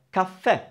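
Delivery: "caffè" in two clear syllables; Italian pronunciation. Shown as a demonstration. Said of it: "Two syllables".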